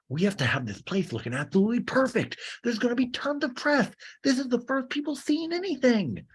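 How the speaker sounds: mock frantic reality star; Sandoval. Bar owner fretting about the press arriving, mock frantic. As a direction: climb very high as the panic builds.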